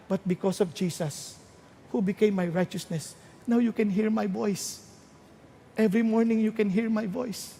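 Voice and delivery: hushed, grateful talk with God; quiet confession turning to gratitude; humble prayer. Acted. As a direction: pray it softly and intimately. Voices a believer's prayer to God.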